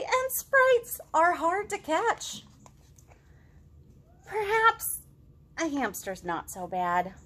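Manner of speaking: lilting rhyming picture-book read-aloud; reading to children. A narrator's reading. Teacher reading a rhyming story to kids, lilting storytime voice.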